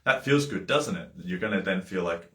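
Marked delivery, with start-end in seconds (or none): 0.0-1.1 higher, louder coaxing leading question. Shown as a demonstration. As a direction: steer them to agree it feels good. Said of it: "the minute that I just, then I say to you".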